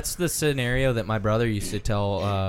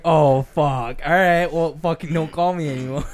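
annoyed brush-off; 5 semitones higher, 6 dB louder than usual. Retold or imitated. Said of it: "he's just like".

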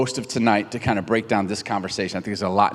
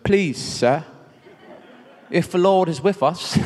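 higher-pitched, hammed-up British accent; 7 semitones higher, slower than usual. Preacher jokingly playing Gideon answering the angel, British accent. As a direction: put on a posh-comic British voice for Gideon. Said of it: "he said it in a British accent".

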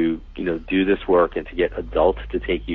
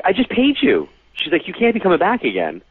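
much higher, sharp indignant mock outrage; indignant host; 12 semitones higher than usual. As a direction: play the irritated host who already paid. Host angry he came back.

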